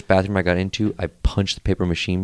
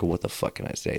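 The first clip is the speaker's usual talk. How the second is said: hushed, voiceless scrambling; whispered inner thought; 9 dB quieter than usual. Retold or imitated. Caught thief searching for a lie, whispered thought. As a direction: whisper it to yourself mid-crisis.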